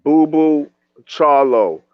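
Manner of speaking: usual speaking voice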